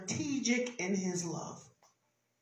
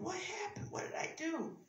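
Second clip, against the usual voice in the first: small, high, soft questioning; bewildered wondering; 10 semitones higher, 13 dB quieter than usual. Acted. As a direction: act out puzzled self-questioning under the breath. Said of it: "I would be wondering".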